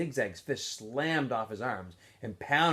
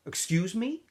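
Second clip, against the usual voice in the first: sharp; 9 semitones higher than usual. Acted. Warden's sharp question.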